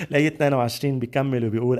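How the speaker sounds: usual speaking voice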